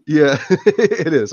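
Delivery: slow words broken by laughter; laughing. Real, not acted. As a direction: laugh through it, pleased. Laughs at cool nickname praise.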